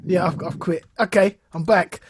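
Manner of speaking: normal talking voice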